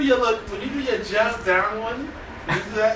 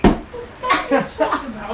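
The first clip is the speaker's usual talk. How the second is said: mock aggressive mother; 7 semitones higher, 5 dB louder than usual. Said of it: "She'd be like".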